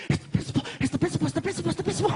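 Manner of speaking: frantic high whispered warning; high panicked child repeating a warning. Retold or imitated. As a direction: hiss it like a kid who spotted the principal. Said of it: "he's like".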